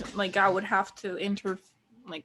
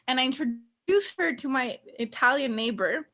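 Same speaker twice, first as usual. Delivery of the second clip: usual speaking voice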